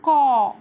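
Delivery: loud, clear model of कौ with an open au; syllable demo. Shown as a demonstration. Teacher modelling कौ loudly for kids. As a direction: belt out कौ for the class. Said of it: "ये क्या बन गया?".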